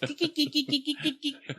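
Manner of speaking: very high, squeaky laugh; squeaky kiki giggle. Real, not acted. Squeaky giggle mimicking blurt.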